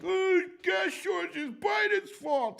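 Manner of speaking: high, loud mocking whine of a Biden critic; mocking a complainer. Mocking people who blame Biden, high whiny shout. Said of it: "these jackasses who are like".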